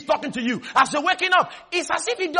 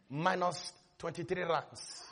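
low, slow, hushed letdown; deflated readout; 9 semitones lower, 16 dB quieter, slower than usual. Retold or imitated. Checking the bank account after praying, quietly deflated. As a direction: let it sink, slow and very soft. Reading out a dismal bank balance.

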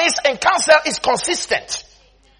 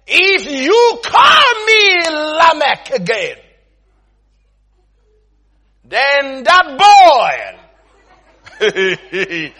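dramatic threat; 2 semitones higher, 5 dB louder, much slower than usual. Acted. Friend warning those mocking him, dramatic threat. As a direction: stretch the threat out loudly, word by word.

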